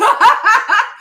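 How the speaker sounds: a sudden high burst of laughter. Real, not acted. Laughing at the couple's drama, high and loud.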